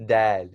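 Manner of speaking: dal with lips spread in a smile and a light D; smiling dal. Shown as a demonstration. Teacher contrasting dal with dal chawal, smiling and light. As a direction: smile on dal, not the lentil word. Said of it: "this is not dal, like dal chawal".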